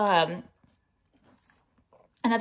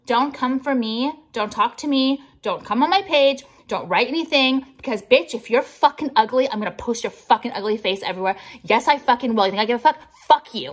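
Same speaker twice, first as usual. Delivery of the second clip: angry rant; 5 dB louder than usual. Real, not acted. Ranting at a hater.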